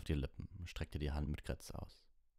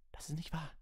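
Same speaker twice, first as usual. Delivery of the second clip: cried out; 13 semitones higher than usual. Acted. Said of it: "rief Harry".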